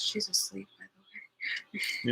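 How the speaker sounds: soft, slow hush near a sleeper; barely voiced, keeping quiet. Real, not acted. Speaking while someone sleeps nearby.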